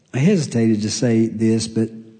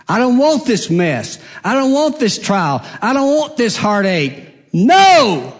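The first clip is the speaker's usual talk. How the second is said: rising higher and louder up to a shouted No; a grown man's tantrum against his trials; kicking and screaming protest; 9 semitones higher, 3 dB louder than usual. Retold or imitated. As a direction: protest harder each line, then shout No. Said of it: "I go kicking and screaming".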